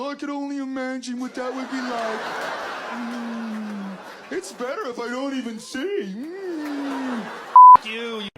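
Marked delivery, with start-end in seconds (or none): 0.0-7.2 a hint of a dreamy snob; mock savoring, eyes closed; smug book snob. Mocking a reader who prefers imagining, smug. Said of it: "sitting there with your eyes closed".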